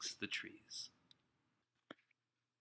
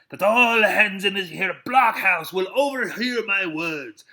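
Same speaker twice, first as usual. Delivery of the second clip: loud, slow call to the whole blockhouse; raised voice; 8 semitones higher, 11 dB louder than usual. A narrator's reading. Silver calling out to all in the blockhouse, loud and slow. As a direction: raise it so every hand hears. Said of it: "raising his voice".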